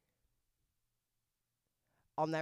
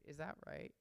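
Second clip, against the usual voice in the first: ordinary talk, nothing marked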